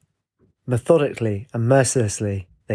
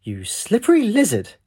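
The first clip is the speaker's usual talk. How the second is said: playful high-pitched scolding, stretched over the words; humorous; 9 semitones higher than usual. A narrator's reading. Lutz finds the captain has slipped away, humorous high tone. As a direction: tease with a high, amused swoop. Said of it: "Lutz said humorously".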